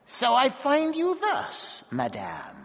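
high, slow, sinister little voice; menacing dwarf. A narrator's reading. Dwarf catching his wife, high, slow and sinister. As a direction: draw out the words with cold menace.

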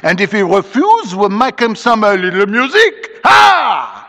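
put-on high, rowdy Creole French accent; boisterous French-accented mob leader; Bienvenu. A narrator's reading. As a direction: threaten merrily, ending on a loud cry. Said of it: "cried Bienvenu".

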